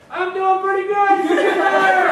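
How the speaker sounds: loud, high flustered shout at a passing car; startled airman. Retold or imitated. Gate guard shouting after the passing car, loud. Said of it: "Airman Jackson says".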